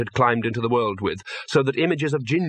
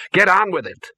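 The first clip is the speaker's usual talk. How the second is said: impatient order; 7 semitones higher, 5 dB louder than usual. Acted. Ginger impatient.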